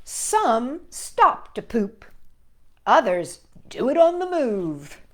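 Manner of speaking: lively read-aloud voice for little listeners; reading to children. A narrator's reading. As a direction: read it like a bedtime picture book. Reading picture book to children.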